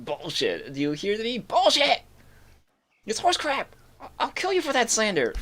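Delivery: berserk rage. Retold or imitated. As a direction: explode in rising fury. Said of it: "he's just like".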